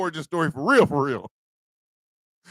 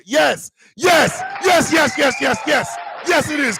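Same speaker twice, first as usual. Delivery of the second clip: excited yes; 6 semitones higher than usual. Real, not acted. Emphatic answer to super chat.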